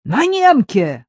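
high, loud, drawn-out exclamation; exclaiming. A narrator's reading. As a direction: burst out with delighted astonishment. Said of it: "воскликнул Иваныч".

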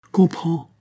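high, sharp command. Acted. Monique ordering Marin to understand, commanding. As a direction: order it, pitched well up. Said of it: "avec le ton du commandement".

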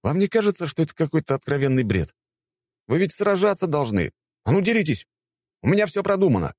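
high, indignant rant; high-pitched, outraged complaining; exasperated scolding. A narrator's reading. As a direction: order them about, pitched right up. Narrator scolds the fake fighters.

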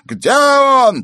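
very high, loud wailing demand; madman's yell. A narrator's reading. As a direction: yell it like an escaped lunatic. Madman demands again, yelling. (истошный вопль безумца)

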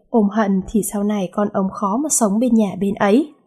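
normal talking voice

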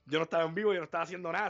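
normal talking voice

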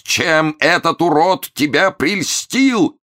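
high, slow, drawn-out howl; full-throated yell. A narrator's reading. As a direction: wail it word by word. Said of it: "заорал он во всю глотку". (протяжный отчаянный вопль)

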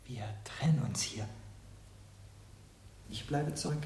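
soft whispered farewell; hushed parting words. Acted. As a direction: let it fade away as you leave. Servant withdraws quietly.

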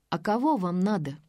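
ordinary delivery

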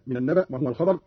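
ordinary talk

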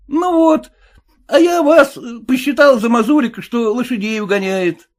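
high suspicious cabbie; cab driver voice. Acted. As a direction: play the sly cabbie, pitched well up.

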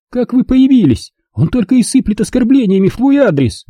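high, angry shouting match; angry quarrel. Acted. Lauren and mother quarrel over Kurt, high angry voices. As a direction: hurl it back.

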